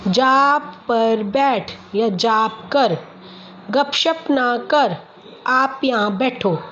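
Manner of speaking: very slow, deliberate articulation; slow practice reading. Shown as a demonstration. Speech teacher reads practice sentences to a child, very slow. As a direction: sound out each word for a child.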